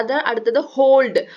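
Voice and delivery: normal talking voice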